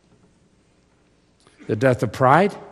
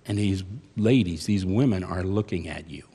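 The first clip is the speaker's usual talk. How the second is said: normal talking voice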